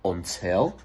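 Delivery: ordinary delivery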